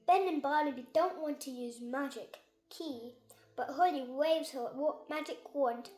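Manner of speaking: ordinary delivery, nothing marked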